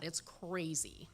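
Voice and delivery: normal talking voice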